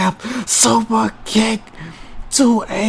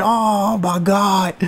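ordinary delivery

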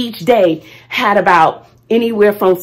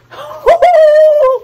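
high burst of laughter; 7 dB louder than usual. Real, not acted. Laughing at the chore.

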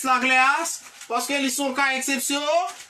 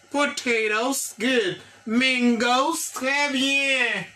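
ordinary talk, nothing marked

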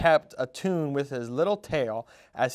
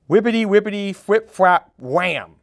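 lively sound effects; 5 semitones higher, 7 dB louder than usual. A narrator's reading. Sound words of falling.

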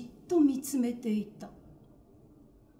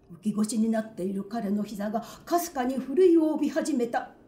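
ordinary delivery, nothing marked

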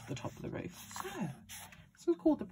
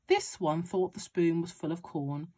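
usual speaking voice

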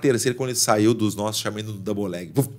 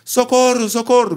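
very high mock Spanish plea; Spanish-accented cry for help; 12 semitones higher than usual. Fighter re-enacting the Spaniard under his punches, high Spanish-accented cry. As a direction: imitate the Spaniard yelping for rescue, voice shot up. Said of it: "em espanhol".

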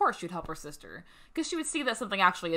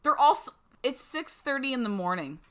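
grumpy sleepy friends; 7 semitones higher, 6 dB louder than usual. Retold or imitated. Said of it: "Garrett and Devin are gonna be like".

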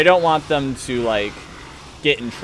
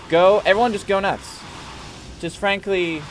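normal talking voice

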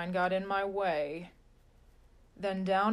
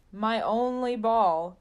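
usual speaking voice

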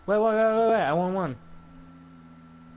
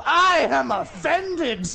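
higher and slow, hammed-up indignation; mock offended; 8 semitones higher, 4 dB louder, much slower than usual. Pretending to be a twelve-year-old, in slow, higher hammed-up outrage. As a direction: drag it out in fake outrage.

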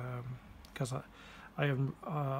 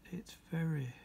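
whispering; 6 dB quieter than usual. Real, not acted. Puzzled by the pen.

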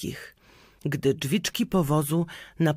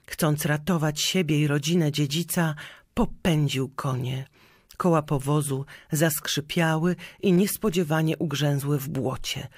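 ordinary delivery, nothing marked

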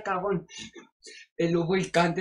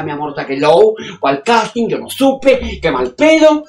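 brash, loud, fast-talking show-off starlet; loud diva; 11 dB louder than usual. Acted. Vain actress on the phone to her agent, loud diva gush. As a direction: gush and snap like a vain celebrity.

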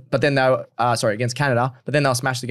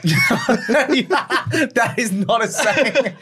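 laughing; 7 semitones higher, much slower than usual. Real, not acted. Laughing at a mate's phrase.

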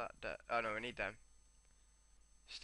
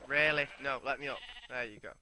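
ordinary delivery

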